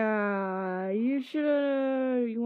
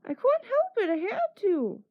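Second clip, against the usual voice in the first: mock innocent Chris; 12 semitones higher than usual. Chris defending himself to the girls in a much higher voice. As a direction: pitch it way up like a kid who can't help it. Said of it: "and Chris is like".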